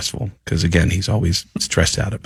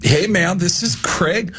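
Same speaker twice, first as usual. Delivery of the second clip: higher, breezy rock-star intro; 7 semitones higher than usual. Retold or imitated. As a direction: toss it off like a careless drummer. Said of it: "introduced me to his band by saying".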